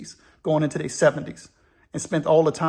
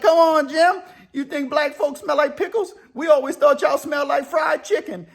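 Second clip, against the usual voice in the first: laughing disbelief; 8 semitones higher than usual. Retold or imitated. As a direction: throw it back at him through the laugh. Al laughing back at Jim.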